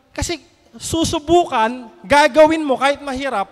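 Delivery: ordinary talk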